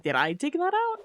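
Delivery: high squeaky startled question; mock surprised self-quote. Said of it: "I'm like".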